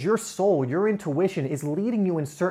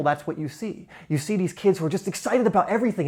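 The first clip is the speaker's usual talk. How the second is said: ordinary talk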